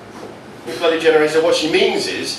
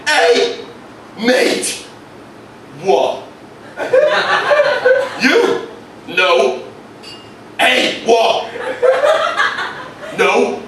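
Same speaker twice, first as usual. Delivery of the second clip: squaring up to a stranger; menacing horse; 14 semitones higher, much slower than usual. Acted. Horse's glare voiced as a thug.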